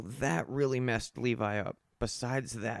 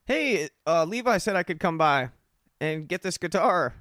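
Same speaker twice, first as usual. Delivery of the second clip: nervous excuse; 4 semitones higher, 6 dB louder than usual. Retold or imitated. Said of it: "And I'm like".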